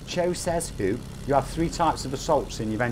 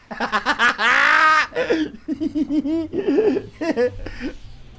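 long, high-pitched cackle; cackling laugh; 13 semitones higher, 4 dB louder than usual. Real, not acted. Laughs at his sniper threat.

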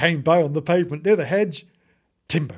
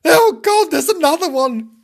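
very high, loud kitten wail; panicked cry; 12 semitones higher, 8 dB louder than usual. Acted. Kitten spotting another car, panicked cry. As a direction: cry out in hammed-up terror. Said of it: "cried Timbo".

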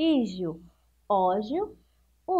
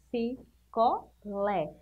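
slow, syllable by syllable; 3 dB quieter, much slower than usual. Shown as a demonstration.

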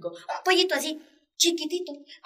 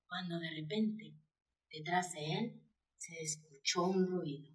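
hushed suspense; 7 semitones lower, 14 dB quieter than usual. A narrator's reading. Suspense in story.

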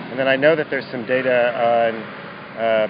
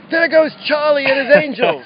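put-on high, loud Boston accent shout; 8 dB louder, slower than usual. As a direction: yell it like a Boston local. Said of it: "she shouts in this, like, Boston accent".

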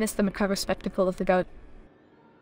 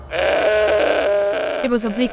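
bleating goat voice; 5 dB louder, much slower than usual. A narrator's reading.

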